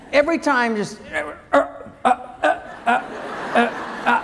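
mock the hand jerking to a halt again and again; laughing stop-grunts.

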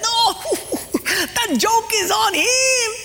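high squeal broken by giggles; gleeful laughing. Acted. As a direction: crow through giggles at fooling someone. Gleeful Portuguese man in the joke.

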